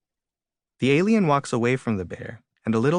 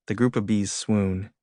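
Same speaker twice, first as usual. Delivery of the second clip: ordinary delivery, nothing marked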